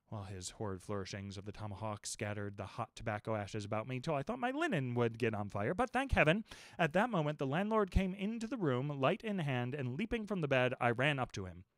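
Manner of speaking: ordinary delivery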